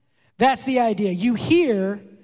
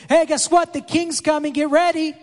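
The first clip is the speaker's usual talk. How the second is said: herald's shout; 4 semitones higher than usual. Retold or imitated. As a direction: announce the coming king to the crowd. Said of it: "they'd be shouting".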